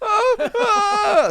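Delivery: high, loud exaggerated moans; mock climax cries. Man on a date exposing himself, mock climax wailing. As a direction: wail it out, hammed-up.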